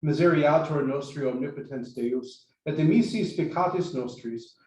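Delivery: loud, steady Latin prayer recitation; liturgical recitation. Shown as a demonstration. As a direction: declaim it like leading mass. Recites the Confiteor for practice.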